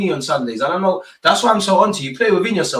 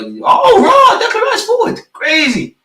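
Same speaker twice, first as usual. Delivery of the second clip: much higher and louder, thrilled outburst; excited shout; 7 dB louder, slower than usual. Real, not acted. Fan cheering Declan Rice's forward run, excited high shout. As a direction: erupt as the midfielder finally goes forward.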